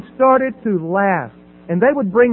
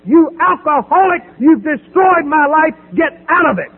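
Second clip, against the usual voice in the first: retold yell; 8 semitones higher, 5 dB louder than usual. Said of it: "he yelled as loud as anything".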